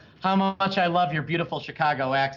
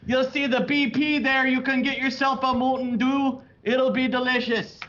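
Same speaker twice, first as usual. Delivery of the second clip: high, sing-song Wisconsin local voice; Wisconsin accent; 8 semitones higher than usual. Retold or imitated. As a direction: play a chirpy Wisconsin local giving directions. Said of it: "They go".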